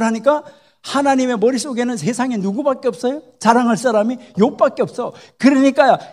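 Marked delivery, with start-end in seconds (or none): 5.4-6.0 a loud, higher, falling 그러니까요; loud emphasis. Real, not acted. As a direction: shout 그러니까요 to seize attention. Preacher builds up to the scene.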